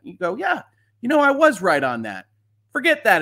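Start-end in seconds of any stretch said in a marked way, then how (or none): 0.4-2.3 high, self-satisfied mock voice that lands louder; hammed-up pleased-with-itself voice; smug mock agreement. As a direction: play the commission patting itself on the back. Commission mockingly agreeing with itself.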